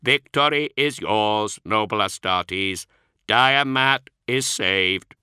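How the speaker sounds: slow, measured, strangely inflected machine voice; a polite machine reciting its excuses; synthetic Magos voice. Acted. The Magos explaining to the Astartes, a slow synthetic voice. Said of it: "Archoi's synthesized voice".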